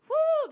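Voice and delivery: high, loud whoop of joy; joyful whoop. Real, not acted. Preacher celebrating that God uses flawed people, high joyful whoop. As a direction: let out a celebratory woo. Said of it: "preacher's exclamation".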